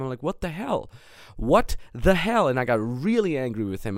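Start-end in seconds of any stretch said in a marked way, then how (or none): 0.2-0.9 retold yell. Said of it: "and I was really yelling". 1.4-2.5 slower and a bit louder, drawn out; mild mock yell, held back in the morning; slow retold yell. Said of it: "and I was really yelling".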